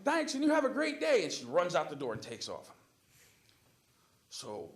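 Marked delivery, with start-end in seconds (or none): 0.0-1.2 high, loud exaggerated politeness; over-bright send-off. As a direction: call out a forced cheery goodbye. Sending off the raging customer.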